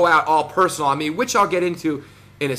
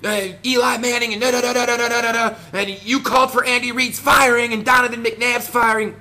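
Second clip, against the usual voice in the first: mocking yell; 6 semitones higher than usual. Mocking a rival vlogger's rant, high mock yell. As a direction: ham up a rival's yelling rant in a higher voice. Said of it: "talking to the top of his lungs like".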